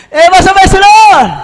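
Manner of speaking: loud, high rallying cry; shouted praise. Real, not acted. Preacher ends the joke with a loud high praise. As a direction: shout it with full force.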